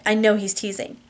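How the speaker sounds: plain reading voice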